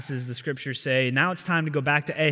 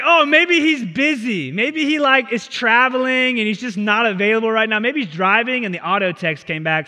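higher, louder sarcastic taunting; mocking; 7 semitones higher, 7 dB louder than usual. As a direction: tease them like a playground bully. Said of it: "He's mocking them".

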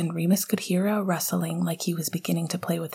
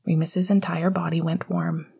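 usual speaking voice

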